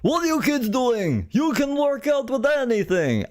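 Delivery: hammed-up Austrian muscleman voice; Arnold Schwarzenegger impression. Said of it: "Arnold Schwarzenegger commercial where he's like".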